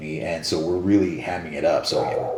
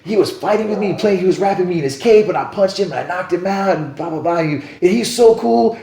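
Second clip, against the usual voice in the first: put-on high-pitched, breathless-with-excitement kid; excited little boy; 14 semitones higher, 6 dB louder than usual. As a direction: gush like a thrilled small child. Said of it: "the little boy's like".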